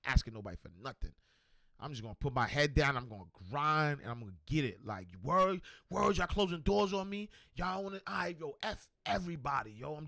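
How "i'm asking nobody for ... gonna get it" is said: mock fed-up rant, fast; frustrated outburst. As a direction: rattle it off, done asking anyone. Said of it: "just got frustrated one day".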